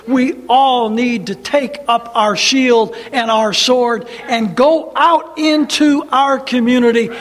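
slow, building to a high roar; rousing shout. Acted. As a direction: build to a roaring battle cry. Climactic call to fight.

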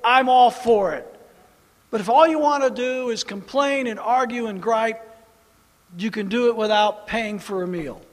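loud fiery sermon; full-force preacher; preaching. Acted. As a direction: drive the point home to the pews.

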